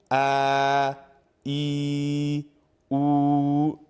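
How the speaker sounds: A, E, U each held long and open from the mouth; prolonged vowels demo. Shown as a demonstration. Teacher demonstrates the mouth letters to viewers, long held vowels. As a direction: stretch each vowel out evenly. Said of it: "this is when you are using your mouth".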